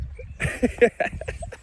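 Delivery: airy, high-pitched giggle. Real, not acted. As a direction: giggle at your own punchline. Laughs at "rushing to nowhere.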